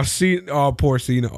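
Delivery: usual speaking voice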